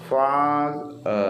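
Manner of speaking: "father" with a long, held first syllable; stressed first syllable. Shown as a demonstration. Teacher demonstrating English word stress to students, first syllable of "father" stressed. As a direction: stretch the first half of "father", then let the second drop. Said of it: "ശബ്ദം കൂട്ടുന്നത്".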